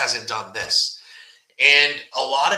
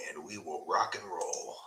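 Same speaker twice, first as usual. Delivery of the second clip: whisper; 6 semitones lower, 11 dB quieter than usual. Real, not acted. Signing off the live stream.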